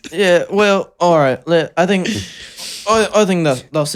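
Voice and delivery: awkward put-on Liverpool sign-off; British accent. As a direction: dither in a silly British accent. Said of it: "you just sound like [name] on drugs".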